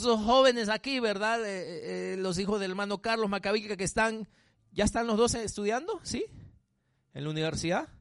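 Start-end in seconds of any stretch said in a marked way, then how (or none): none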